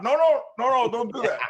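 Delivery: high, loud stop with a laugh; half-laughing frantic plea; laughing urgent shout. Retold or imitated. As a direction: call them off, cracking up. Stopping guard again.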